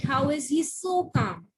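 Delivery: ordinary talk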